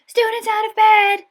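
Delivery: very loud, high slow bellow. A narrator's reading. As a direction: raise the alarm at full voice. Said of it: "Peeves bellowed".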